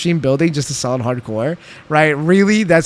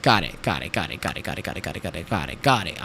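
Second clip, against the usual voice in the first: distracted muttering; 11 semitones lower, 9 dB quieter, faster than usual. Real, not acted. Rattled off while focused building.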